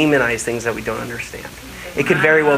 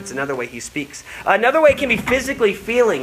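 ordinary delivery

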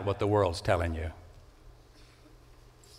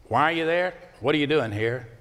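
usual speaking voice

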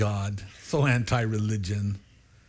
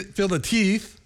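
usual speaking voice

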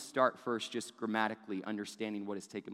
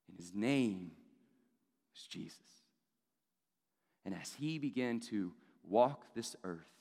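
hushed sermon storytelling; 5 semitones lower than usual. Acted. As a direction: drop the voice and let each phrase land. Hushed telling of the birth.

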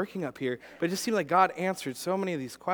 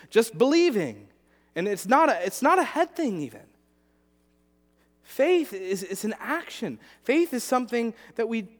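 higher, louder full-force sermon; impassioned preaching; 8 semitones higher, 5 dB louder than usual. Acted. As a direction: preach it with fire.

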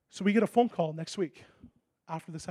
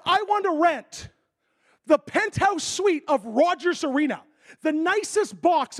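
slow, higher and louder; friend's eager plan; 8 semitones higher, 5 dB louder, slower than usual. Retold or imitated. Business friend telling the preacher his big plan, eager. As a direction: savour each word.

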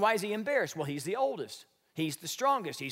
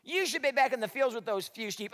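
a taunting Eliab; mocking older brother; 7 semitones higher than usual. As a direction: sneer down at the little brother. Eliab mocking David, preacher voicing.

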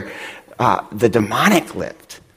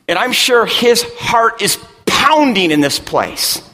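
preaching; 10 semitones higher than usual. Acted. Pastor driving the point home to his congregation, preaching. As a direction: preach it to the room with growing force.

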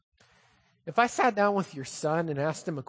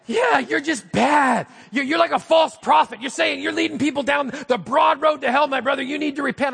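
scolding rebuke; 6 semitones higher, 5 dB louder than usual. Retold or imitated. Critic scolding the pastor as false prophet, loud. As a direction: scold him like a stern critic.

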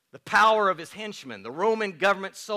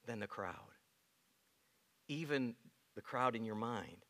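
tender appeal; 7 semitones lower, 17 dB quieter than usual. Acted. Pastor comforting congregation about Jesus's view, tender hush. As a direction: lower your voice and comfort them.

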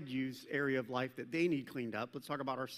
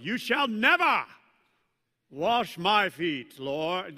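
much louder, slow, puffed-up proud declaration; pompous mock Peter; 9 dB louder, slower than usual. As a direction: ham it up like he's proud of himself. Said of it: "he probably didn't say it like that".

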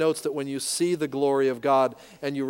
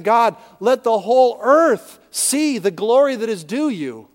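higher and louder pleading prayer; fervent prayer; 6 semitones higher, 6 dB louder than usual. Pretend. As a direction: cry out the prayer with fervor. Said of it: "we're praying".

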